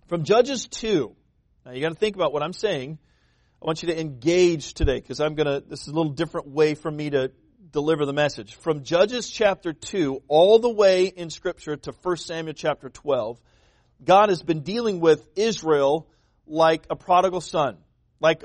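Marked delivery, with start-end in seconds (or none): none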